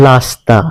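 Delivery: রাস্তা said as লাস্তা with l; Chittagong dialect pronunciation. Shown as a demonstration. Teacher showing the Chittagong r-to-l shift in লাস্তা. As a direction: swap the r for l in লাস্তা. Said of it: "র ধ্বনিকে ল উচ্চারণ".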